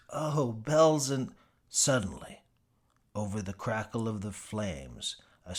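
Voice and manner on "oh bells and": dawning realization. Acted. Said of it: "finally gets it".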